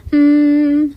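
hummingbird hum. A narrator's reading. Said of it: "hum like the hummingbirds".

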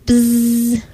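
bee buzz. A narrator's reading. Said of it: "buzz like the bees".

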